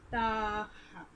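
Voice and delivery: "ta ha" with TA drawn out; stretched "taaa ha"; little long accent. Shown as a demonstration. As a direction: hold the A of TA, then add HA. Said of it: "read in a little long accent".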